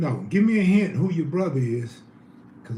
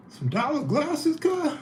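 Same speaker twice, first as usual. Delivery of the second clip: incredulous; 9 semitones higher than usual. Real, not acted.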